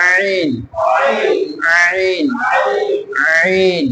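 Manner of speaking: আইন said with the throat squeezed from the middle; teacher modelling আইন with a firm throat press; throat-pressed ain. Shown as a demonstration. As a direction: press আইন out from mid-throat, slowly and clearly. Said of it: "এজন্য আমরা চাপ দিয়ে পড়ব".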